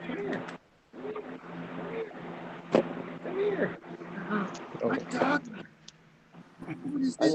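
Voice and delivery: squeaky voice for the dog; high-pitched pet talk. Real, not acted. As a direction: coo at the puppy up high. Talking to the dog.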